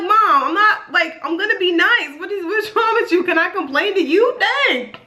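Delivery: high, whiny retold complaint to mom; exasperated protest. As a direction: act out a daughter pleading to be allowed to vent.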